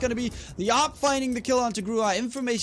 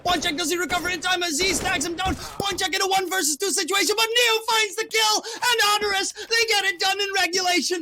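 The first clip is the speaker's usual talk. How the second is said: excited shout; 9 semitones higher than usual. Real, not acted. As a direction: roar the comeback win. Caster calls match-winning round.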